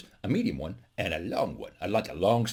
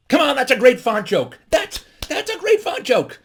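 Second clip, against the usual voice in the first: loud, high-pitched mock outrage; mock indignation; 13 semitones higher, 6 dB louder, slower than usual. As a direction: plead loudly that the joke deserves better. Protests that his joke is great.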